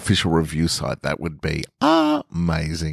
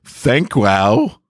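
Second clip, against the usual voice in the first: flustered blurt; 6 semitones higher, 3 dB louder than usual. Retold or imitated. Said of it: "it comes out like".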